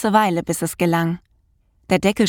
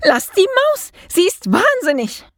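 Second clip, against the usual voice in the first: very high-pitched frantic call; loud cry; 12 semitones higher, 3 dB louder than usual. A narrator's reading. Maid warns the cat off the mouse, shrieking. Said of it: "laut gerufen".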